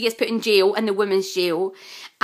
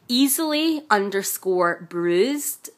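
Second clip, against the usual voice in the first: slow spelling out; much slower than usual. Shown as a demonstration. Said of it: "It's at".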